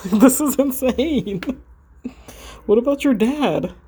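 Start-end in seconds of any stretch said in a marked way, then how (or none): 0.1-1.4 laughing disbelief. Real, not acted.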